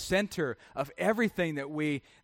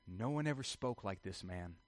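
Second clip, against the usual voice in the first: low, soft, subdued admission; hushed awe; 9 semitones lower, 14 dB quieter than usual. Acted. Officers explain themselves to the Pharisees, in hushed awe. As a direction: say it softly, as if still stunned. Officers report back, awed by Jesus.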